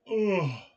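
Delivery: loud disgusted groan; annoyed ugh. Real, not acted. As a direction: groan out the frustration. Frustrated with Lego pieces.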